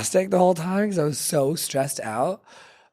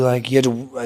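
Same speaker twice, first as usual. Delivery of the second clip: ordinary talk, nothing marked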